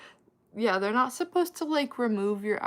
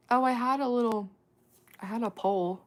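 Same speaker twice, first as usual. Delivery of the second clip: usual speaking voice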